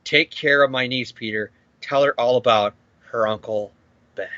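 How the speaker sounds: low, fading deathbed voice; mock dying words. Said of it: "saying his final dying words".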